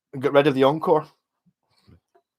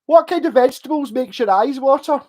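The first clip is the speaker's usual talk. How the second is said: put-on high, loud excited kid on the phone; wee boy caller; 9 semitones higher, 7 dB louder than usual. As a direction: squeak it out like a wee boy calling the radio. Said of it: "D J was like".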